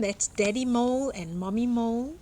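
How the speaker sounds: ordinary delivery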